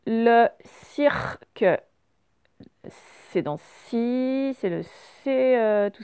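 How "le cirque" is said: LE CIRQUE very slow, as CIR-QUE; teacher sounding out CIRQUE; sounded out slowly. Shown as a demonstration. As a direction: drag out CIRQUE in two beats. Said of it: "on va prendre mot par mot".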